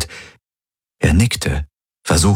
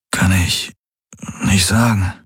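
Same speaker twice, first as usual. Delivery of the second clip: groggy and weak. A narrator's reading. Weak patient answers haltingly.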